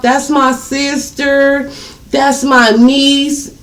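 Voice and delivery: slow, high mocking excuse. Mocking a guy's sister excuse, slow and high. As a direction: drag it out mockingly. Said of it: "he talking about".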